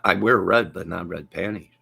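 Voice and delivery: ordinary delivery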